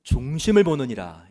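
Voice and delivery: booming, loud proclamation of God's word. Acted. Preacher voicing God rebuking Samuel, loud and commanding. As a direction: thunder it out as God rebuking the prophet. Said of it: "이렇게 말씀하셨습니다".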